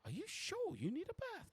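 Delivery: very high-pitched character voice; hammed-up high woman's voice; desk woman. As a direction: ask the guest in a high, cooing pitch. Desk woman fawns on noble.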